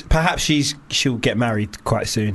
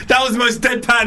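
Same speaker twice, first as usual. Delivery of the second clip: laughing; 9 semitones higher than usual. Real, not acted.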